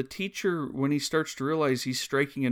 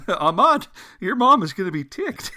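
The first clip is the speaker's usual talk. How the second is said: higher, much louder mock-warning call; 6 semitones higher, 9 dB louder than usual. Viewer calling to the boy on screen, playfully alarmed. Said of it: "I'm like".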